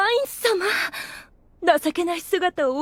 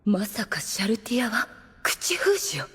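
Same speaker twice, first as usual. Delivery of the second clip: breathy whispered suspicion; whispered aside; 7 semitones lower, 6 dB quieter than usual. Acted. Albedo suspecting Shalltear silenced Aura, whispered aside. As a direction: mutter it under your breath.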